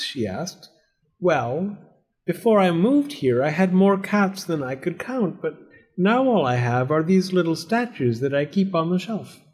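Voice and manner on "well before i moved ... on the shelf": lightly voiced, higher storybook lady; a hint of an innocent fibber; evasive cat owner. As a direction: explain it away innocently.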